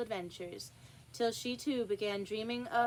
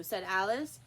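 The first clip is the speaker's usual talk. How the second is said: ordinary delivery, nothing marked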